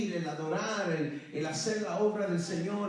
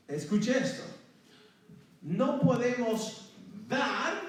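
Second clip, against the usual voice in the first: ordinary delivery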